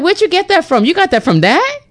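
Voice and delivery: where did you get that, incredulous and high. Retold or imitated. Incredulous questioning of someone overthinking. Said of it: "I'm like".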